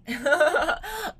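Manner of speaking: loud high laugh at her own joke; sudden bright laugh; burst of laughter. Real, not acted. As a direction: laugh out at the teasing. Jokes about a band.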